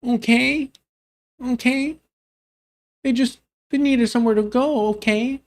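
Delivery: high sarcastic mock voice. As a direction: parrot the excuse in a high sneering tone. Mocking the guest's excuse.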